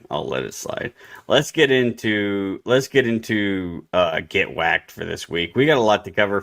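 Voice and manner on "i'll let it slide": much lower and softer; trailing murmur; fading. Shown as a demonstration. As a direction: let it die away under your breath.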